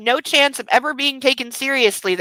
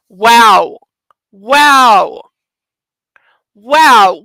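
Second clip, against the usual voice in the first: loud stunned repeated wow; shocked wows getting breathier; 10 dB louder, much slower than usual. Real, not acted. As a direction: react in disbelief. Shocked reaction to revelation.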